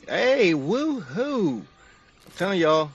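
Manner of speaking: loud, high, drawn-out whoop; hyped cheer. Real, not acted. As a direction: cheer like you just scored. Celebrating a finished order.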